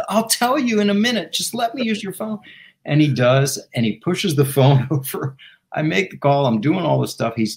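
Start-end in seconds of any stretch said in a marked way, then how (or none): 0.1-2.4 high-pitched, exasperated bargaining; retold impatient plea. Caller begging the ranger for the phone, impatient plea. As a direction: put him off and push for the phone, pitched up.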